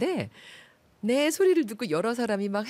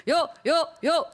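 swim coach call; 7 semitones higher, 4 dB louder, much slower than usual. Shown as a demonstration. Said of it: "수영 코치 같은 소리".

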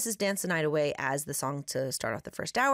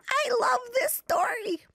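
excited. Real, not acted. Host reacts after story.

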